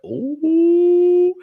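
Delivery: high, loud drawn-out gasp of shock; a long scandalised ooh. Retold or imitated. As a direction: squeal of disbelief. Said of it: "I was like".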